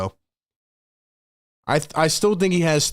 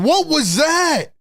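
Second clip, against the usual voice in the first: higher, louder, slow disbelieving shout; shocked shout; 12 semitones higher, 3 dB louder, slower than usual. Real, not acted. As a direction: yell out in disbelief at the play. Shocked by a bad play.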